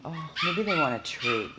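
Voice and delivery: normal talking voice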